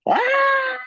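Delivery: very high, loud mock scream; sudden piercing mock yelp of realization; imitated shriek. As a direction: shriek in horror, imitating the interviewer. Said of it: "she just went".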